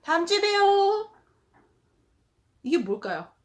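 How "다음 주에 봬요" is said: much higher, louder, syrupy teacher voice; hammed-up breezy tutor brushing off a parent; mocking teacher's reply. Teacher mocking a tutor's empty reply to a mother, high and sugary. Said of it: "그러면 선생님 대답?".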